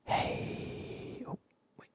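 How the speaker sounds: ordinary delivery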